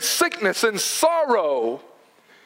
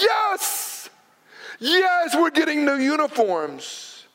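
put-on excited kid cheer; 4 semitones higher, slower than usual. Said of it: "And I'd be like".

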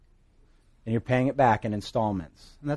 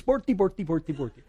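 higher playful fake Swedish babble; mock Swedish; 5 semitones higher than usual. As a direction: tease wife with fake Swedish. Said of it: "joking around with my wife".

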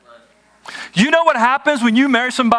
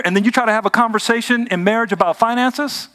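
ordinary talk

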